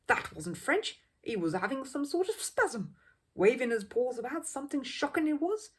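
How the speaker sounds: outraged at the bear's antics; scandalised bystander. Acted. Second man disagrees, indignant.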